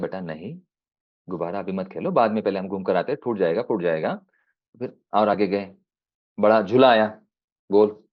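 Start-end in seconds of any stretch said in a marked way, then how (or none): 0.0-4.2 soft fatherly voice. Acted. Father refuses the balloon.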